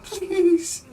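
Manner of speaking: high, loud acted begging; frightened pleading. Victim begging Bone for mercy, high mock-frightened plea.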